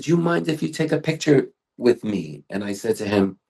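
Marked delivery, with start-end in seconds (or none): 0.0-2.4 higher, lightly put-on French accent; imitating a French stranger asking for a photo. A French fan on a street corner asks for a photo, light French accent. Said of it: "this guy there with a strong French accent".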